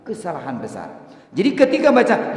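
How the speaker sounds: normal talking voice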